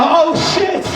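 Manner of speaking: high-pitched comic yelp; mortified shock. Retold or imitated. Worker realizing he called Nancy Nasty, shocked. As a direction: blurt it as the mistake hits you. Realizing he misheard her name.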